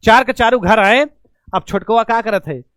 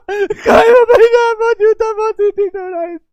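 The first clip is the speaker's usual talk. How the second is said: put-on high wailing sob of a child; child sobbing about his broken whistle shoe; crying child; 11 semitones higher than usual.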